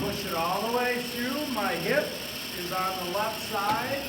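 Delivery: projecting over a running saw; shouting over table saw. Real, not acted.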